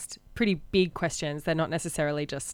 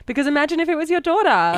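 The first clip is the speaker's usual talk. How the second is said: higher, louder mock earnestness; sarcastic; 9 semitones higher, 7 dB louder than usual. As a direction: parody a preachy celebrity. Sarcastically voicing Louis CK's argument.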